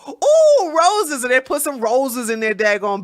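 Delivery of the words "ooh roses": very high, loud drawn-out ooh; mock delight. Imitating centrists who see roses, mock delight. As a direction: gush as if enchanted, then drop. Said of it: "they go".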